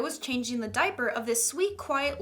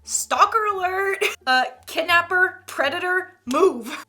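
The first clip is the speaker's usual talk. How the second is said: loud, slow mock alarm call; 6 semitones higher, 6 dB louder than usual. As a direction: sound the alarm, half joking. Alarmed reaction to creepy story.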